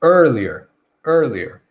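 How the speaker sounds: "Earlier" as ER-lee-er, first syllable strong; stress on first syllable. Shown as a demonstration. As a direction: stress the er of "Earlier", relax the rest short. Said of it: "So we're gonna stress it".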